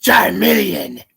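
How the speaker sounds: Pokemon cry. Retold or imitated. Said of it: "Charmeleon's voice is more like".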